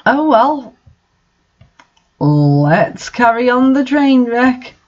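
ordinary delivery

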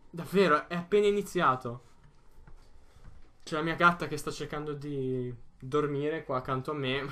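ordinary talk, nothing marked

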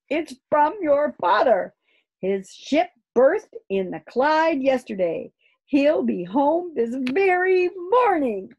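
high, loud and thrilled; joyful announcement. A narrator's reading. Mother telling the children their father is coming home, overjoyed. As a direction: build to a drawn-out, delighted finish. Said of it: "She looked very happy".

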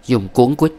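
plain reading voice

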